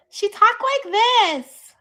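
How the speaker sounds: very high, loud, drawn-out put-on voice; exaggerated loud high-pitched impression of someone; imitating her talk. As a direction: mimic a woman's peculiar high voice, stretched out. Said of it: "That was the way she talked".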